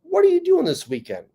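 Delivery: bright call to a friend, pitch jumping high; mock cheery greeting. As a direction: sing out the question to Ray. Calling friend in line.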